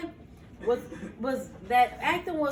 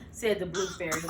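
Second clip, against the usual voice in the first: ordinary delivery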